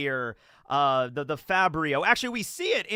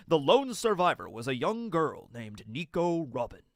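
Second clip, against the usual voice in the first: grand anime narrator with swooping, measured cadence; One Piece narrator. Acted. Reading the Ohara recap to viewers, dramatic narrator voice. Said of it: "in the One Piece narrator voice".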